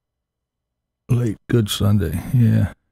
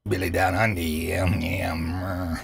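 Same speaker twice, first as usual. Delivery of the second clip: imitating Billy; 7 dB quieter, slower than usual. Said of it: "I gotta do it like Billy".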